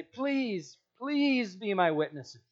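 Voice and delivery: please rising high like a beggar; mock pleading. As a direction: plead on your knees, hammed-up. Preacher mocks begging.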